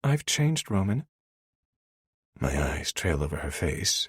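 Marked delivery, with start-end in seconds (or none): 0.0-1.0 put-on higher, miffed young woman; offended woman. A narrator's reading. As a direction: say it as the hurt heroine. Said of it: "seemingly offended".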